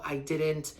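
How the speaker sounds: ordinary talk, nothing marked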